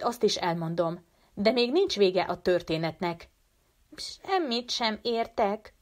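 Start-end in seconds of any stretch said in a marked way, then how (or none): 4.0-5.7 lightly voiced little girl, confused; Maréke cuts in, lightly voiced puzzlement; Maréke interrupting. A narrator's reading. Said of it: "szólt közbe Maréke hirtelen".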